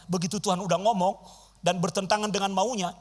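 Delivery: ordinary talk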